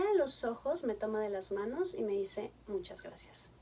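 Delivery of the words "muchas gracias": soft, whispered tearful thanks. Retold or imitated. Grateful mother thanks her son's therapist, whispered through tears.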